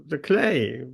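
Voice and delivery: usual speaking voice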